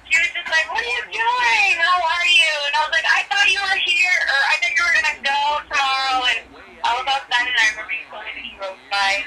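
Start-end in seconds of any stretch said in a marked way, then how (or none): 0.7-2.5 high, loud gushing hello, retold; imitated excited greeting. Voices Annie's greeting on the phone. 3.1-6.2 high, animated puzzled reply; retold surprise. Retells own surprised reply to Annie.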